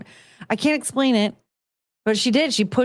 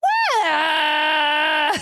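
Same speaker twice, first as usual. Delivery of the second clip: concert yell; 7 semitones higher than usual. Retold or imitated. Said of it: "And I was like".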